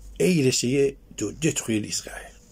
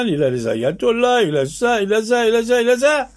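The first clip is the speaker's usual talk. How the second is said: rising, louder and higher on each repeat; exasperated listing; 11 semitones higher, 6 dB louder than usual. Real, not acted. Speaker denouncing the double game, mounting exasperated tirade. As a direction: build to a high, loud pitch of exasperation.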